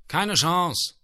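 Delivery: höher, mit anderer Stimme nachgesprochen; Hörner echoen spöttisch; nachäffend wiederholt. A narrator's reading. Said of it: "Und die Hörner wiederholten". (Hörner wiederholen spöttisch das Urteil, höher)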